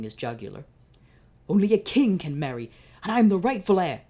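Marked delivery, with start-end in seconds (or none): 1.5-4.0 much louder, higher declaration; raging villain. Acted. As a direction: roar your claim to the throne. Villain claims throne.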